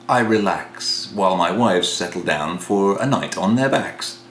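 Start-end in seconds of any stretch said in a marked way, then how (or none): none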